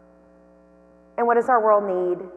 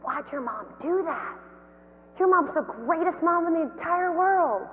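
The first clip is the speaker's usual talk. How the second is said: put-on kids gushing; 8 semitones higher, 5 dB quieter than usual. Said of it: "they were both like".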